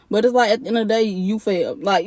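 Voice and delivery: normal talking voice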